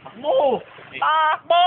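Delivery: urgent yell; shouting. Real, not acted. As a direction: call out, running. Friends urging a run.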